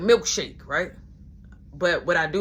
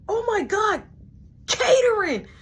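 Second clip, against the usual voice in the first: excited squeal; 13 semitones higher, 5 dB louder than usual. Retold or imitated. Brother greets the snacks.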